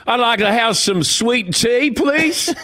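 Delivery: high, hammed-up Southern drawl; mock-polite Southern order with a rising end; Southern expressions. Coach asking for sweet tea, mock Southern accent. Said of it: "the Southern expressions".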